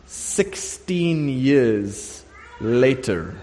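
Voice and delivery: very slow, stretched words; drawn-out time jump. Real, not acted. Preacher marking a long halt in the temple story, drawn out. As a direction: let each word hang to show the long wait.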